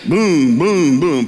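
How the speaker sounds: imitating the car being yanked out; loud boom. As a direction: hit each boom hard.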